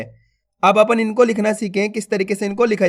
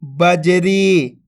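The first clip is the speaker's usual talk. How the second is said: slow blended word; much slower than usual. Shown as a demonstration. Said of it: "तो मिलके बन जाएगा".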